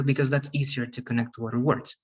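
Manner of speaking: normal talking voice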